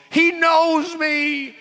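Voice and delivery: high, slow, awestruck; drawn out in high-pitched disbelief; stunned realization. Retold or imitated. Saul stunned that God knows his name, slow and high.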